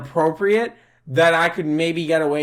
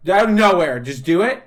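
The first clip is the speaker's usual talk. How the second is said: ordinary talk, nothing marked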